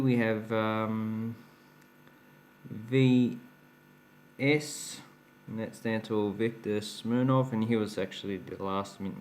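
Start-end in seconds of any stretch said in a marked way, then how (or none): none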